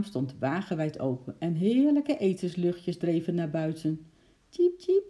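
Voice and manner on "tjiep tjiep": very high, louder chirps; squirrel's squeak. A narrator's reading. Eki smelling food from the kitchen, squeaky cheeping. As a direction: squeak like a hungry little squirrel. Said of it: "piepte Eki".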